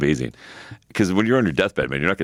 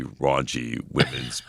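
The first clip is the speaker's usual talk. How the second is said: ordinary talk, nothing marked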